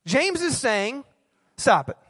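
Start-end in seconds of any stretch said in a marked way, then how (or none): none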